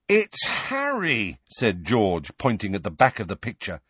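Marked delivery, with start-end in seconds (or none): 0.1-1.4 mock announcement. George announcing the painting.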